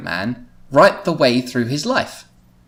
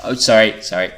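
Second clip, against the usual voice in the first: usual speaking voice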